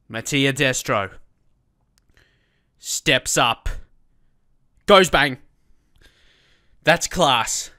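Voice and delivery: building, loudest on goes bang; mounting play-by-play; penalty commentary. Real, not acted. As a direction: call the spot kick like a commentator.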